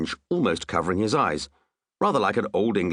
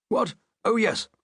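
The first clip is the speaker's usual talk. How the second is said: startled king; 13 semitones higher than usual. A narrator's reading.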